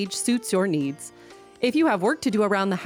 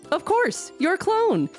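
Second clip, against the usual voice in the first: excited; slower than usual. Acted. Ad punchline, pitch jumps.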